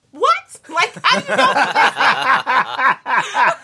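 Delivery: high, loud laughing disbelief; shocked disbelief. Real, not acted. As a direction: shriek of amazement, laughing. Shocked laughing reaction to Jimmy.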